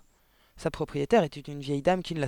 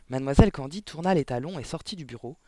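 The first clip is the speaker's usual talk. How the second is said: plain reading voice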